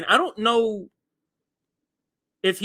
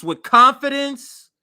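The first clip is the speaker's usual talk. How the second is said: firm, assured and louder; confident tone; 3 semitones higher, 8 dB louder than usual. Shown as a demonstration. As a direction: sound sure of yourself. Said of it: "you're talking like this".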